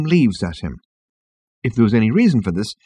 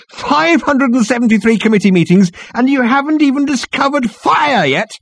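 very high-pitched outburst; exasperated exclamation; 5 dB louder than usual. A narrator's reading. Ford berates the committee, exclaiming. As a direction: rail at them in disbelief. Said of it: "he exclaimed".

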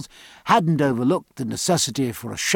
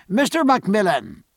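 high, loud summons; calling out; 7 semitones higher, 4 dB louder than usual. Acted. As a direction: call to an assistant across the tent. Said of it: "he called in the hospital tent".

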